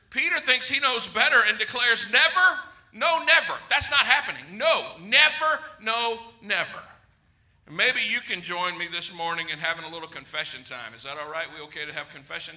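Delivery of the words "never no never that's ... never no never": NEVER and NO pitched high, punched, then falling; emphatic refusal. Retold or imitated. Peter declaring he knows better, emphatic "never, no, never". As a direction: insist on every "never" and "no", high and separated. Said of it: "It's so emphatic, Peter is declaring".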